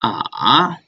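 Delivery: ah dipping down then up; third tone ah. Shown as a demonstration. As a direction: Mandarin fall-rise tone on ah. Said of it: "The third one is ah".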